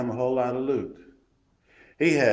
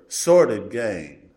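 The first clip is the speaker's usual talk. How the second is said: "sordid gain" slowed right down, sordid hit hard and loud; stress on sordid; 3 semitones lower, 5 dB louder, much slower than usual. Shown as a demonstration. Preacher underlining "sordid gain" for the congregation, slow and heavy. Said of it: "underline that word".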